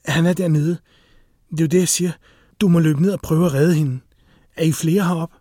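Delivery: acted frightened insistence; urgent alarm. A narrator's reading. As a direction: press him to act at once. Frightened woman urges him to help.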